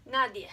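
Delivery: "nadie" in Spanish, stressed first syllable, ending glided into one; two syllables. Shown as a demonstration. Teacher shows a diphthong, "nadie" in two syllables. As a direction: say "nadie" as NAH-dyeh. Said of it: "two syllables".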